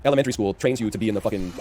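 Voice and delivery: usual speaking voice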